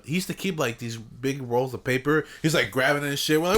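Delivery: ordinary talk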